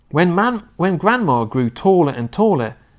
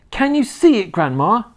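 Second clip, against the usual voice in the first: high, eager call; George's raised, boyish shout; 10 semitones higher, 4 dB louder than usual. A narrator's reading. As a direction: call up to Grandma with excitement. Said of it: "George shouted".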